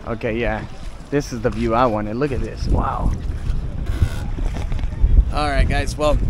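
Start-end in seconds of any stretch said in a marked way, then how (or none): none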